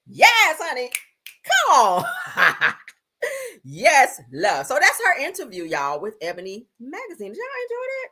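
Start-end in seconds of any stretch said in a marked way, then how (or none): 0.1-4.7 high, loud cheer with a laugh; high hyped cheer. Real, not acted. Cheering after reading Mel's answer, high and loud. 7.3-8.1 high playful. Real, not acted. Asking audience after bit.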